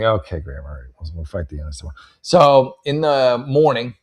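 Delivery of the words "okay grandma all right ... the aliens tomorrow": put-on reluctant kid. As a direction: give in without any enthusiasm. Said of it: "I'm like".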